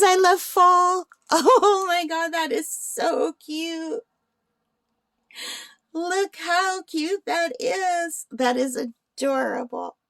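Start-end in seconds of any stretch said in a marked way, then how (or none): 0.0-1.1 excited. Real, not acted. Reads the cute painting's text. 1.3-8.3 high, slow delighted gushing; excited gushing. Real, not acted. Laughing, gushing over cute painting.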